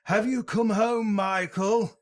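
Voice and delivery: mock-dramatic doctor calling to Michael; Loomis impression. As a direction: ham up the creepy doctor. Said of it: "starts saying things like".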